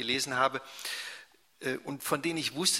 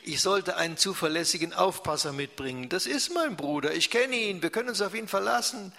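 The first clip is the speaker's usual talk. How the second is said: plain reading voice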